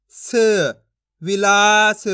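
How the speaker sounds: "स" alone, then loud, stretched "विलास"; loud teacher's model of "स", "विलास"; sounding out and blending. Shown as a demonstration. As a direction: hold the long vowel in "विलास", sound final "स" fully. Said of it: "एक एक अक्षर का उच्चारण करके".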